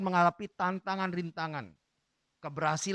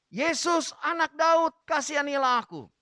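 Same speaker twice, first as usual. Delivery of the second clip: calling out; 8 semitones higher, 5 dB louder than usual. Acted. Blind beggar crying out to Jesus for mercy, high and loud. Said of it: "dan dia berseru".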